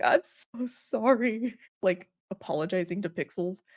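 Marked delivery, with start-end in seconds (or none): 0.0-1.6 mock apology. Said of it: "And I was like".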